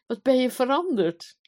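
high, loud, amazed exclamation; delighted surprise. A narrator's reading. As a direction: exclaim at a welcome change. Queen astonished by changed husband. (verrast en verrukt)

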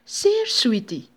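high, slow, loud American character; drawn-out hammed-up delight; fake American. A narrator's reading. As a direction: savour it as the put-on American. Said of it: "antwoordde de Amerikaan".

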